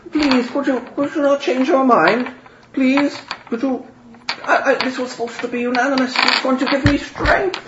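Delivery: very high, tearful begging; pleading. Acted. Wolfgang kneeling before Father Russian, high pitiful pleading. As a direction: beg him on one knee. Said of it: "gets down on, on one knee".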